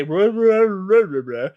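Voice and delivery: high, loud nonsense "ruh ruh"; garbled mumble. Retold or imitated. Dazed Galakrond recalling a muffled voice, garbled nonsense. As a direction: mimic muffled voices heard while stunned.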